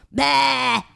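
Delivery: high taunting puppet noise; cheeky raspberry. A narrator's reading. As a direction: blow it out like a rude kid. Puppet sticks out tongue.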